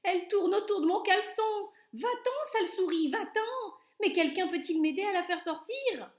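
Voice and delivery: high, loud, fast panicked pleading; frantic shout. A narrator's reading. Cook with a mouse in his underpants, fast high loud panic. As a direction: babble in panic to shoo it off. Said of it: "criait l'homme".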